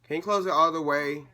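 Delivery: loud, high call across the room; real shouted request. Real, not acted. Vlogger calling after departing intruder, annoyed shout. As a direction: holler it after someone leaving.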